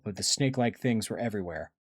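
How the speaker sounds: usual speaking voice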